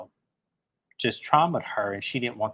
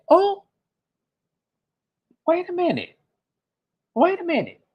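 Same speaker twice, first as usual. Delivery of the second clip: much higher and louder, stunned; shocked disbelief; 4 dB louder than usual. Retold or imitated. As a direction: react like you can't believe your ears. Host reacting to shocking remark.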